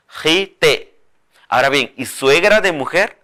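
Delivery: ordinary delivery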